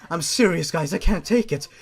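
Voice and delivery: ordinary talk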